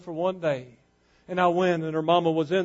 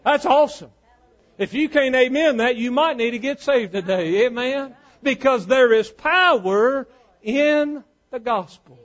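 raised, emphatic pulpit call; fervent preaching; 7 semitones higher, 7 dB louder than usual. Acted. Preacher calls the church to amen, fervent preaching. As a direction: stretch the key words as you proclaim.

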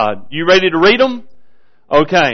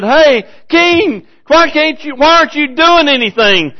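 high pleading cry to the king; a desperate woman calling up to him; 8 semitones higher than usual. Retold or imitated. Starving woman calls up to the king, high and pleading.

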